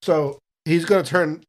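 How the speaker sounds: ordinary talk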